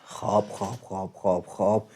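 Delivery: very soft, low, hushed self-soothing chant; mock calming meditation. Calming himself before his live viewers, hushed mock meditation. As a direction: lull yourself down, half joking. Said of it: "یه خورده آروم باش پدرام".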